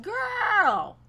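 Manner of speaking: high loud indignant retort. Retold or imitated. Retelling her snap at the loud woman, indignant. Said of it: "I'm like".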